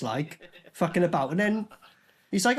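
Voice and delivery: usual speaking voice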